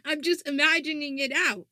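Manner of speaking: ordinary talk, nothing marked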